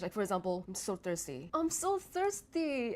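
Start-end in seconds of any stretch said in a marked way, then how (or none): 0.7-1.5 low, soft, unexcited statement; quiet, low-pitched delivery. Shown as a demonstration. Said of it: "you can do it with just pitch". 1.5-2.9 high, drawn-out excited exclamation; exaggerated high-pitched excitement. Shown as a demonstration. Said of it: "just the way I deliver it".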